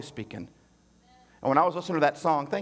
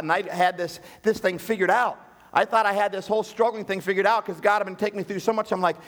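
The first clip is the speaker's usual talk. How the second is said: ordinary talk, nothing marked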